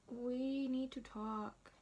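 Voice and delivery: slow, drawn-out, weighty delivery; ominous announcement. Retold or imitated. Texting boyfriend before breaking news, slowly ominous. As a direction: spell doom with each slow word.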